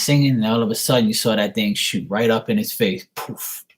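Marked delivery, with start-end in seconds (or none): 3.2-3.7 soft, voiceless "poof" puff; imitated firecracker burst.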